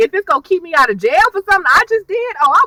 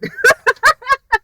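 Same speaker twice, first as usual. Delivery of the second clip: high burst of laughter; 10 semitones higher than usual. Real, not acted. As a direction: laugh hard at yourself, way up high. Laughing at her own reply.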